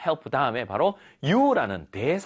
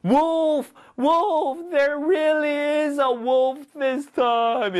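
high, loud, drawn-out alarm cry; shouting; 11 semitones higher, 5 dB louder, much slower than usual. Acted. Shepherd boy alerting the town, shouting. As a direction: cry wolf at a high pitch, stretching the words. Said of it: "Shouting, 소리 지르면서".

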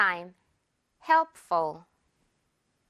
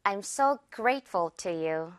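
slow demo; 3 semitones lower, 3 dB quieter, much slower than usual. Shown as a demonstration. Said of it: "한 번 더요".

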